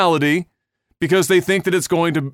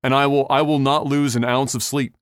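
normal talking voice

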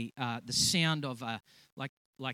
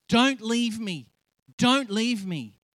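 higher, louder, slow pleading; a frightened friend begging higher and slower; desperate pleading; 8 semitones higher, 6 dB louder, slower than usual. Retold or imitated. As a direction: beg him not to go, drawn out. Friend pleading during deliverance.

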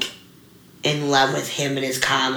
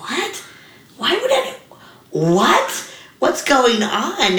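much higher, stunned, in slowing disbelief; shocked disbelief; 8 semitones higher than usual. Retold or imitated. Coaches react to her tearful news, imitated with high shocked disbelief. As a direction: gasp out the coaches' baffled what. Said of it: "the coaches and the team were just like".

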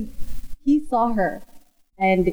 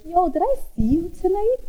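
mocking a guy; 11 semitones higher than usual. Mocking guy's late DM.

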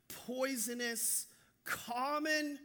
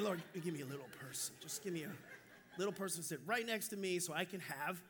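low, hushed mock plea to God; mock prayer; 6 semitones lower, 7 dB quieter than usual. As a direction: pray under your breath, comically earnest. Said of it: "I pray for little people".

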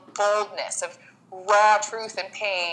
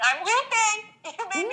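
laughing through tears; 13 semitones higher than usual. Real, not acted. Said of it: "am I gonna start weeping?".

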